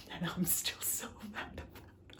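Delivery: hushed, near-whispered venting; seething. Real, not acted. Reader still upset about the ending, hushed seething. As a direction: drop to an exasperated whisper.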